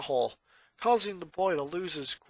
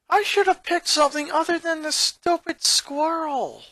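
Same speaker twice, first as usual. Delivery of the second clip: lightly voiced grumbling boy; 10 semitones higher than usual. A narrator's reading. Boy griping alone in room.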